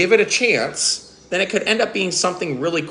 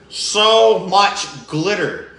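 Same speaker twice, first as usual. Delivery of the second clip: slow disgusted groan at the glitter; exasperated drawn-out complaint; 2 semitones higher, much slower than usual. Acted. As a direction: stretch it out, slow and fed up. Goblin groaning about glitter.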